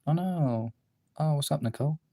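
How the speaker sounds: ordinary delivery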